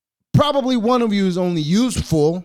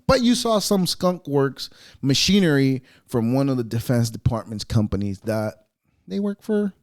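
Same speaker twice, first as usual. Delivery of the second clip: usual speaking voice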